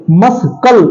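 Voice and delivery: "मस्कल" broken as mas-kal, k sounded; wrong pronunciation. Shown as a demonstration. Teacher breaking down the mistake, wrong pronunciation. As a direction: split "मस्कल" in two with a clear k. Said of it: "मस्कल नहीं होता है".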